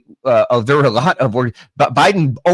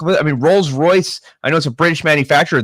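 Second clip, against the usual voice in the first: ordinary talk, nothing marked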